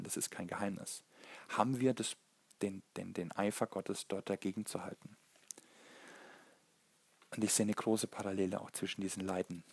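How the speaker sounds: ordinary delivery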